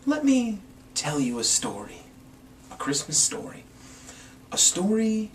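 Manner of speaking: soft, secretive story opening; hushed storyteller intro. A narrator's reading. Host welcoming a listener to a Christmas tale, hushed. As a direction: draw the listener in quietly.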